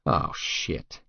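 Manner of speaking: low, soft, breathy dismay; whispered oh shit. Acted. Narrator realising she'll cry, whispered dismay. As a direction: mutter it under your breath. Narrator dreads her crying.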